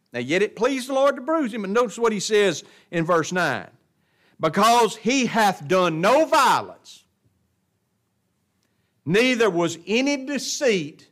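high, ringing scripture proclamation; forceful preaching. Real, not acted. Preacher contrasting verse nine for the congregation, forceful preaching.

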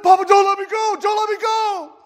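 put-on high, loud pleading child's cry; panicked child. Boy floating in the pool, begging grandpa in panic. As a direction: beg not to be let go.

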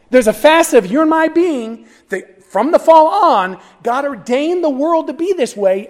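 impassioned preaching. Real, not acted. Preacher telling listeners what God ordained for them, fervent. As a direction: press it on the congregation, high and urgent.

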